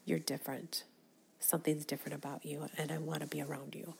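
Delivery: soft, quick whisper of awe; hushed quote. Retold or imitated. Imagined stranger telling her softly she is different. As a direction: murmur it in wonder. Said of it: "somebody goes".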